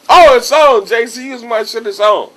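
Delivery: high, loud burst of excitement; imagining his big break, all hyped; hyped up inside. Retold or imitated.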